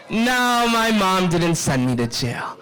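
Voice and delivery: ordinary delivery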